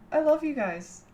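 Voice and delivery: giggle, then tell them you love them; high giggle then gush. Real, not acted. Streamer giggling as she tells chat she loves them.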